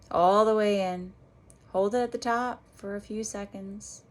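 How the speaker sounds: unhurried meditation guide with long pauses; guided meditation. Real, not acted. Meditation teacher guiding listeners back to their breath, guided meditation voice. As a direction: lead them gently through each breath, leaving space between phrases.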